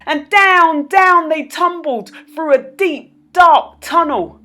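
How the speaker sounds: high, loud tumbling narration; dramatic fall. A narrator's reading. Narrator tells the children tumbling down, high and loud. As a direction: sweep them down the tunnel.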